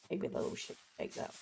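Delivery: quick whispered aside; whispering. Real, not acted. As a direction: whisper it to yourself.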